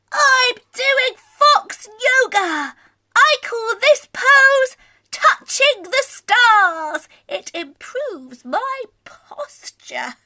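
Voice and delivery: a gentle, pleased little fox showing off a pose; calm Scaredy Fox. Acted. Voices Scaredy Fox in story.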